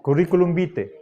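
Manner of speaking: "vitae" as BI-te, A silent; "Curriculum vitae" with AE reduced to E; Castilian pronunciation. Shown as a demonstration. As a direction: say "Curriculum vitae" ending in plain E. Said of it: "la pronunciación castellana de esta palabra".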